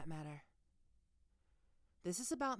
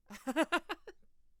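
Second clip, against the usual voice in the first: a loud, high mocking laugh; 9 semitones higher, 9 dB louder than usual. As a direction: laugh in his face. Laughs at student's protest.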